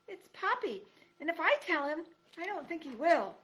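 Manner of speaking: high, pleading mouse voice; small timid mouse begging; mouse girl pleading. Acted. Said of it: "mouse corrects timidly".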